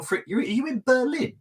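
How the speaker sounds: high-pitched real surprise, rising at the end; surprised question. Real, not acted. Host querying co-host about being in Berlin, surprised. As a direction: ask it in rising disbelief. Surprised banter over a selfie location.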